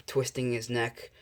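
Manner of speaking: ordinary delivery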